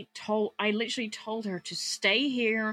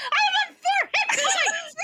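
very high, loud outraged squeal; alarmed protest; 6 dB louder than usual. Real, not acted. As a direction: shriek it in protest, drawn out. Player protests fall damage.

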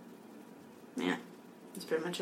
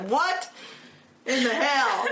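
mock angry; 6 semitones higher, slower than usual. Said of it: "I got really mad".

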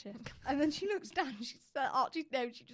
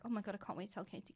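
fast excited; 2 semitones lower, 9 dB quieter, faster than usual. Retold or imitated. Excited rehearsal.

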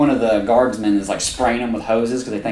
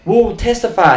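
eager reassurance; 9 semitones higher, 3 dB louder than usual. Retold or imitated.

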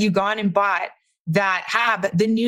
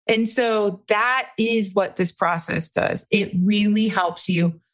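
ordinary talk, nothing marked

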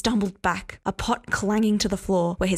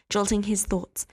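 ordinary delivery

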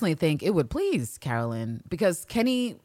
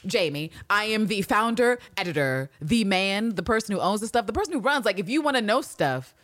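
high, fast eager chatter; eager Jamie impression; 5 semitones higher than usual. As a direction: gush about himself like an overeager guy. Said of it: "again to be like".

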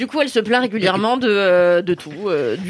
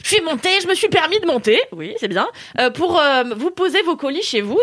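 lightly voiced concierge, higher and chirpy; imitating the concierge; 8 semitones higher than usual. Concierge explaining she went upstairs, a light higher voice. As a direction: play the concierge's sing-song, higher. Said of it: "Elle me dit".

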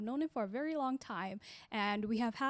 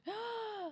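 surprised gasp; 11 semitones higher, 5 dB quieter than usual. Real, not acted. Surprised at the date.

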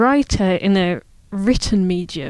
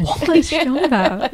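higher-pitched puzzled question through a laugh; mock bafflement, laughing; 6 semitones higher than usual. Imagined Spaniard hearing an English idiom, laughingly baffled. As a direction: imitate a baffled stranger while laughing.

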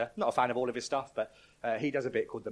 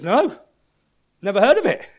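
much higher, much louder reply; cheerfully clueless thief; 12 semitones higher, 17 dB louder than usual. Retold or imitated. Pastor voicing the thief questioned by an angel, high and loud. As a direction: blurt it out, proudly ignorant. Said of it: "He goes".

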